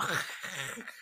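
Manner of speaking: soft rasping rush of breath; imitated fire noise. Describing her anger as fire, imitated whoosh. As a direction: breathe out a quiet roar of flames.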